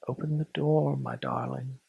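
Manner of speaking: low and quiet, almost breathless; very quietly. A narrator's reading. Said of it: "very quietly indeed".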